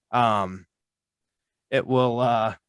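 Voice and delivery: stalling, fighting off a sneeze; halting while stifling a sneeze; holding back a sneeze. Real, not acted. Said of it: "I'm trying not to sneeze".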